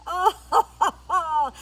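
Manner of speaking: loud, high booming "oh-ho-ho"; hammed-up storybook guffaw; lion's belly laugh. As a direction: laugh uproariously as a lion. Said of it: "he began to laugh".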